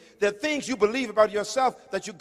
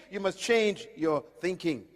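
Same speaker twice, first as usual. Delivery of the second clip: usual speaking voice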